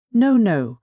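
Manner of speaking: loud, high protest; crying out. A narrator's reading. Professor protesting to the widow, loud and high. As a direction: cry out in alarm. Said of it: "cried the professor".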